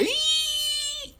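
high squealing brake noise; mimicking brakes slamming, high squeal; imitated brake screech. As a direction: shriek like tires skidding. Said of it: "All you hear".

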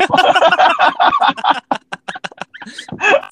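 a real high laugh bursting out; real amused laughter, pitched high. Real, not acted. Laughing at the payphone story.

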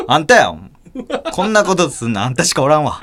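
loud accusing yell broken by laughter; laughing accusation. Retold or imitated. Mother blaming the boy, retold through laughter. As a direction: accuse while cracking up.

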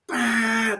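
imitated car horn. Driver honking at a dad in the lot, a mock horn blast. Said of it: "this loud horn".

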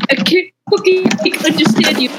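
lightly hammed-up baby talk; mock baby talk. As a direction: coo it like a dad to a baby. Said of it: "like, baby talking her".

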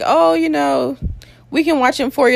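high, coaxing imitation; lightly hammed-up offer, pitched well up; mock helpful staff. Nurses offering to watch her baby, mock coaxing.

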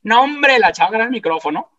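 retelling the big moment, exclaiming with excitement; excited burst. Real, not acted.

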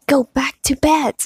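high, indignant order; furious. A narrator's reading. Boy ordering Hermione back to bed, furious. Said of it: "said Ron furiously".

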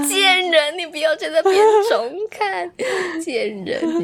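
mock outrage at a friend; laughing playful jab; teasing insult. Real, not acted.

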